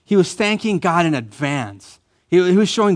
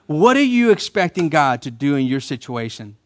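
usual speaking voice

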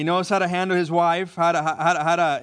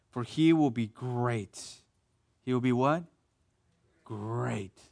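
soft, unhurried reading of scripture; 6 semitones lower, 6 dB quieter than usual. Acted. Pastor reading Luke to the congregation, soft and slow. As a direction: let the verses settle gently. Reads scripture softly, slowly.

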